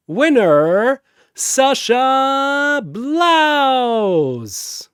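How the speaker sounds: loud, high, stretched-out call; announcer call. Acted. Zurubang announcing the winner to the crowd, loud and drawn out. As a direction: boom it to the arena.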